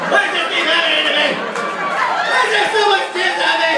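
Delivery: high, fast whining complaint; whiny Kurt Russell. Retold or imitated. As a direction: whine like a bewildered hero. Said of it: "Kurt Russell's just covered in jizz".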